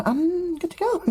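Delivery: very high, odd little voice; high-pitched eccentric researcher; hunchback character. Acted. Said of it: "at the goblins and goes".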